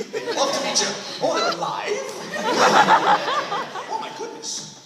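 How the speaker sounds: a princess gushing about brownies, high and giggly; laughing mock princess. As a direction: squeal through giggles at the news.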